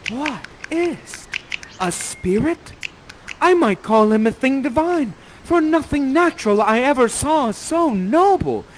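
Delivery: high, awestruck verse line; crying out in wonder. Acted. Miranda first sees the beautiful man, crying out in wonder. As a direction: marvel aloud at a beautiful stranger. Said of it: "you cry out and say".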